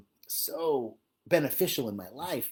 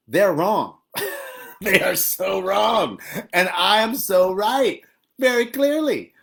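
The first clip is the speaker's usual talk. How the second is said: loud, high, slow insisting she is so right; mock righteous certainty; 5 semitones higher, 7 dB louder, slower than usual. Speaker to live viewers, hamming up being right. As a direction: ham up how right you are.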